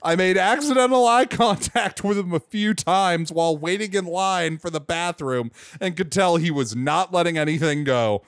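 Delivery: ordinary delivery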